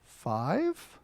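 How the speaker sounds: put-on small, soft questioning child voice; soft innocent question, sliding up at the end; little boy's reply. Five-year-old son answering his irritated dad, small questioning voice. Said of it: "in his squeaky little voice".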